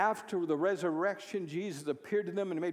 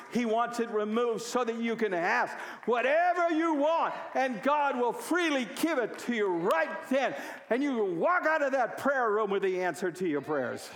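rising to a shouted, high preaching climax; a preacher working the congregation into a loud, high climax; preaching at full force; 5 semitones higher, 4 dB louder than usual. Real, not acted. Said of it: "That's good preaching".

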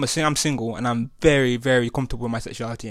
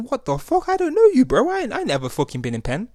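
incredulous; 10 semitones higher than usual. Retold or imitated. Retold reaction to stranger.

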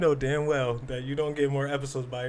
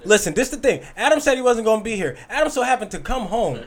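higher, louder, insistent explaining; heated protest; 7 semitones higher, 3 dB louder than usual. Real, not acted. Defending skipping to co-host who came home early, heated. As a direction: argue your case over the other host. Arguing over missed episode.

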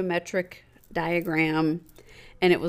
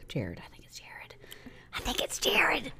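hushed, unvoiced whisper; very soft, secretive whisper; whispered aside; 8 semitones higher, 9 dB quieter than usual. Real, not acted. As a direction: whisper the name as an unsure guess. Unsure of name, whispering it.

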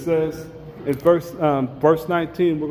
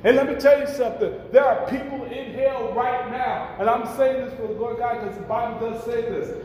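raised, urgent preacher's voice; fervent preaching; 5 semitones higher, 3 dB quieter than usual. Acted. Preacher warning the congregation about people in hell, fervent. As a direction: press the warning home.

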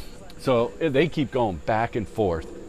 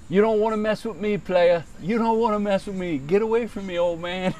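mock tough threat; 8 semitones higher than usual. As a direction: imitate a young guy talking big at an old man. Young golfer acted threatening old man.

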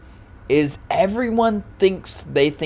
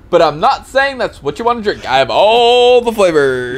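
high, loud, anxious to please; hammed-up people-pleasing; mock overeager offer; 7 semitones higher, 6 dB louder than usual. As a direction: backpedal eagerly, hamming up the politeness. Skit of overeager, awkward drink offer.

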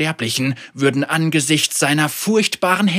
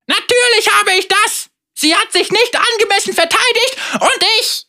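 hohe, eitle Zauberer-Stimme; überheblich angeben; prahlender Bösewicht; 5 dB louder than usual. Acted. Vega boasts. (hoch und großspurig prahlend)

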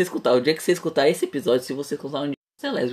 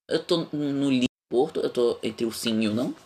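ordinary talk, nothing marked

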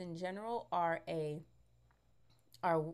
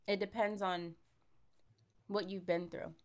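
usual speaking voice

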